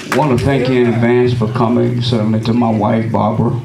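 normal talking voice

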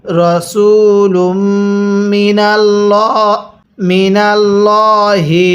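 রসুলুম মিনাল্লাহি chanted slowly; slow Quranic recitation. Shown as a demonstration. Teacher reads the joined phrase back slowly. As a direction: recite the phrase slowly, each sound held. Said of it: "এরপরে".

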